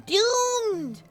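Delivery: high, drawn-out dramatic "Doomed"; hammed-up doom from a scheming octopus; mock villain threat. Octopus persona threatens humanity.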